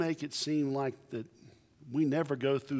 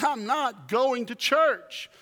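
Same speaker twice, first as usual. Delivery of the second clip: high, slow, stubborn whine; sulky refusal; 9 semitones higher, 4 dB louder, slower than usual. Retold or imitated. Man to his wife, high slow whine. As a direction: drag it out like a stubborn child. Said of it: "He says".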